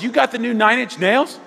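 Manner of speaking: a friend's loud, high, keen question; excited friend. Retold or imitated. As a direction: play the pumped-up friend showing off a disc. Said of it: "He goes".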